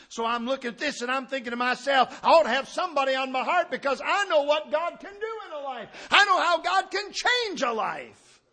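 high, loud pulpit preaching; ringing out like a fired-up preacher; full-force preaching. Real, not acted. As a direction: preach it hard to the congregation.

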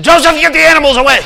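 loud, fast, high cry; urgent shout. Retold or imitated. Mary telling Joseph to clear the animals, a fast loud shout. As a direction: yell it in a rush. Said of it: "in a sweet way".

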